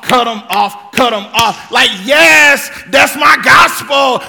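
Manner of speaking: loud, shouting parody of hype preaching; mock hype preaching. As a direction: ham up the catchy feel-good sermon.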